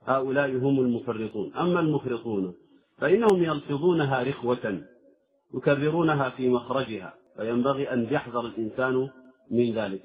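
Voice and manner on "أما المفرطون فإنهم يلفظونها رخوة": ordinary talk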